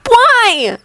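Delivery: put-on high insistent boy's question; demanding boy. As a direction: demand an answer. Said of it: "he demanded".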